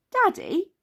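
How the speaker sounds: put-on high child's call. A narrator's reading. Peppa over the noise of the helicopter, calling out. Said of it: "called Peppa over the noise of the helicopter".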